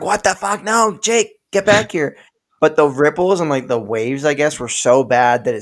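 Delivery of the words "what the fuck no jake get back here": high, alarmed calls after him; family shouting from the boat in alarm; panicked yelling. Retold or imitated. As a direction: yell at him to come back, alarmed. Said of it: "everyone's like".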